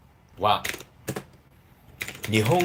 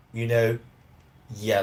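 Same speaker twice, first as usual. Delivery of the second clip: ordinary talk, nothing marked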